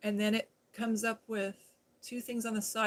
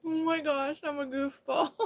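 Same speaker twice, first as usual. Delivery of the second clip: higher, laughing at herself; 5 semitones higher, 4 dB louder than usual. Real, not acted. Laughing at her own mistake to Mikey. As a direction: giggle as you call yourself silly.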